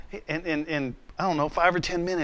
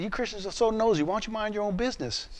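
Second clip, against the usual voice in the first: higher-pitched teasing rebuke, kept soft; joking complaint in a raised pitch; mock scolding; 6 semitones higher, 5 dB quieter than usual. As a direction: playfully tell off some nosy people. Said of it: "I'm joking".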